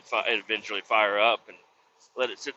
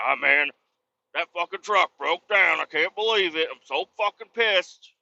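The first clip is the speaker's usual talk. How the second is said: higher-pitched mock complaint; upset; 4 semitones higher than usual. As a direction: imitate someone griping about a breakdown. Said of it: "the other person would be really upset".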